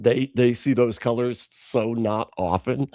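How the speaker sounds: ordinary delivery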